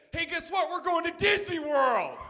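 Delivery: high, excited shout; mock a thrilled kid's big announcement; excited kid bragging. Friend bragging to the kids.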